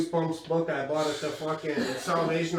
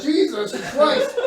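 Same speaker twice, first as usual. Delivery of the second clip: higher, slow and laughing; laughing exasperation; 8 semitones higher, 3 dB louder, slower than usual. Retold or imitated. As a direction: groan it out while laughing. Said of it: "I'm like".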